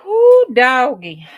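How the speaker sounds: loud, drawn-out delighted holler; excited whoop. Real, not acted. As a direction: whoop it out with joy. Exclaiming over pretty fabric.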